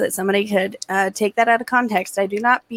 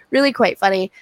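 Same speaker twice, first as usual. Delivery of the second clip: normal talking voice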